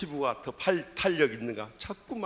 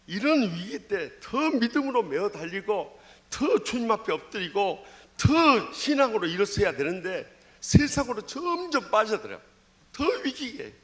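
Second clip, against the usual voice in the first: rising, louder preacher's exhortation, slowing to press each phrase; preaching at full force; 6 semitones higher, 4 dB louder than usual. Acted. Preacher urging the congregation toward faith, in full fervent preaching voice.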